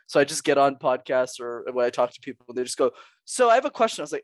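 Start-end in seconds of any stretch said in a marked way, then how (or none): 3.3-4.0 high-pitched, louder mock host; imitated podcast host's question. Podcast host about to ask the guest's name, imitated. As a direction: pitch it up as a mimicked interviewer.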